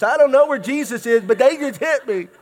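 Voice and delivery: whiny tattling little sibling. Retold or imitated. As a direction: whine back at the parent. Kid answers parent.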